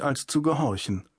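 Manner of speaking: plain reading voice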